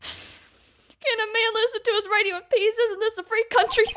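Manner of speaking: hammed-up high southern-Shaggy protest; pubescent witness. Witness claims free country in court, pubescent high voice. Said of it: "Larry's going through puberty while in court".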